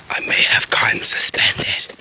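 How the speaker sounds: whispered confession; hushed admission. Real, not acted. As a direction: drop to a whisper like a guilty secret.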